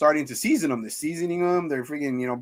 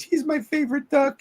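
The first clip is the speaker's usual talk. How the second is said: high-pitched mock woman's voice; imitated Beverly; 13 semitones higher than usual. Retelling Beverly standing up for the duck, high imitation. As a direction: pitch it way up as her quoted protest.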